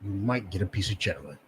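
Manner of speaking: a sly master dangling a reward; slave master's taunt. Retold or imitated. Low soft slave master tempting the slave. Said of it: "He's like".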